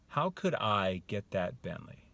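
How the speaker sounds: slow, thoughtful question at normal volume; open wondering. Shown as a demonstration. Man pondering how to get the Bentley, wondering. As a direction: ask as if truly seeking a way. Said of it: "You hear the differences?".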